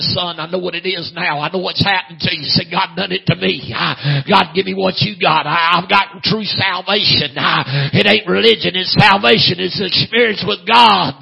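building, loud revival cadence; fervent preaching. Retold or imitated. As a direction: testify with rising force, punching each phrase. Preaches mother's conversion at full force.

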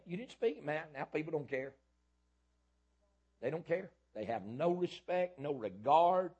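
loud, high, hammering sermon voice; full-force preaching. Acted. Preacher shouting the word to his church, loud and high. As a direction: thunder the word at the congregation.

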